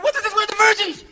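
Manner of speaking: high, loud, indignant whine; whiny bewildered protest. Acted. Bin Laden protesting at heaven's gate, high whine. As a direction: squeal it in confusion. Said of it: "he's going".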